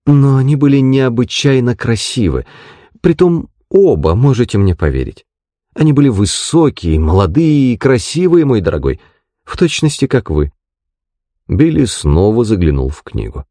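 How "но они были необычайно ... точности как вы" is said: lightly voiced, higher-pitched doting landlady; gushing landlady. (восторженная хозяйка, чуть выше голосом)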